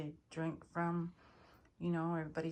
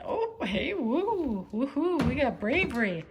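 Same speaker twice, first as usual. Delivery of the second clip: excited; 6 semitones higher, 8 dB louder than usual. Real, not acted. Cat comes in, excited.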